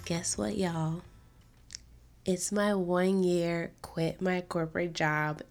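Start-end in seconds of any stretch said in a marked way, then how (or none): none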